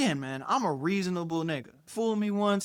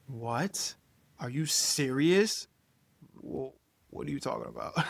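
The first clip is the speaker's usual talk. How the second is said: low, quiet imitated incredulity; understated put-on disbelief; mock disbelief; 3 semitones lower, 4 dB quieter than usual. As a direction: retell her stunned questioning, hushed and halting. Imitating girlfriend's disbelieving reaction.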